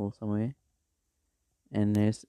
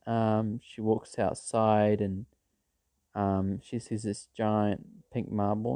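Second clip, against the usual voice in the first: ordinary talk, nothing marked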